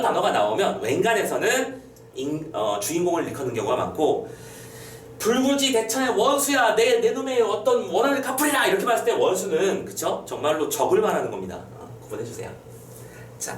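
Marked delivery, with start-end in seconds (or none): 5.2-8.7 mildly dramatic vow in a raised pitch; acted oath of revenge; vow of vengeance. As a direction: declaim it at an enemy, pitched up.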